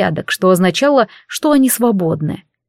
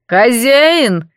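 calling out; 4 semitones higher, 3 dB louder than usual. A narrator's reading. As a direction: call loudly from across the room. Guest calls from the hall. (громкий оклик)

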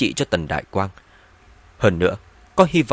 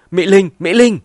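high, loud, urgent shout; shouting after someone hanging up; hurried loud calling; 9 semitones higher, 9 dB louder than usual. A narrator's reading. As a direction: call out the name urgently, raised in pitch. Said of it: "vội vàng hô to vài tiếng".

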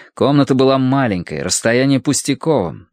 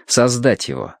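plain reading voice

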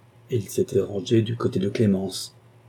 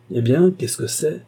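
higher, slower and louder, a booming demand; exclaiming loudly; 7 semitones higher, 4 dB louder than usual. A narrator's reading. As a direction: burst in calling out what is going on. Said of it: "s'écria-t-il en ouvrant la porte".

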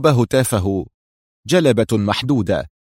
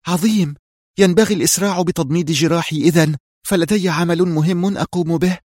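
higher-pitched, keen woman's voice; eager excitement; 7 semitones higher than usual. A narrator's reading. Reham to companions, urging haste, excited. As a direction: seize on a new plan, pitched up. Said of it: "تألقت عيناها وتجمدت أنفاسها وهي تقول".

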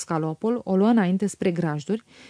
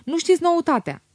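higher, louder news shout; announcing loudly; 9 semitones higher than usual. A narrator's reading. Stănică bursting in with news for the family, higher and louder. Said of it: "vesti el tare".

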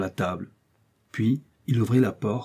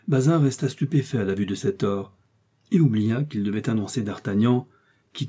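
plain reading voice

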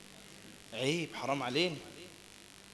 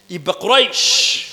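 emphatic slow call; 4 semitones higher, 14 dB louder, much slower than usual. Real, not acted. Teacher stresses key answer.

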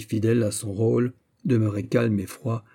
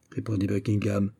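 plain reading voice

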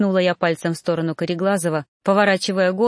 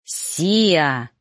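drawn-out warning call of a name; warning growl. A narrator's reading. Mentor Ноан cautions his trainee, warning growl. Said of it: "предостерегающе зарычал Ноан".

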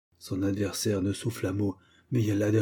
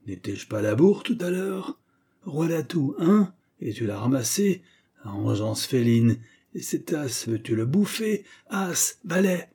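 high, louder triumphant taunting; gloating grandfather; 4 semitones higher, 4 dB louder than usual. Acted. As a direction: rub the win in her face. Grandfather gloats over trumps.

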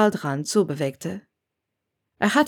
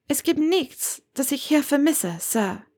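put-on higher, lively young woman's voice; young witch Hermione, defiant; 8 semitones higher than usual. A narrator's reading. Hermione defends her life to Severus, higher and lively. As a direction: speak up brightly for her as she defends her choice.

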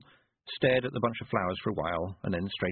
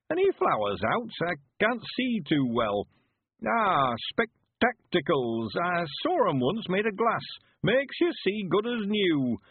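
put-on high, slow rustic old-timer; high, swooping old man's dialect voice; old country man, eager; 10 semitones higher, slower than usual. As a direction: draw out the words as an excited old villager. Narrator plays old Mister Weevil.

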